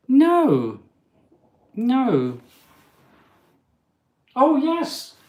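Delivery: high, loud exclamations; high-pitched startled outburst; real surprise. Real, not acted. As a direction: cry out in disbelief, then discovery. Spotting spider on wall.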